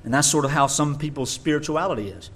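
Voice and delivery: ordinary delivery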